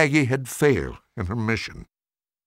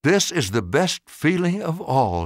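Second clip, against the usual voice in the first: inner thought; 6 semitones higher than usual. A narrator's reading. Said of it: "she thought".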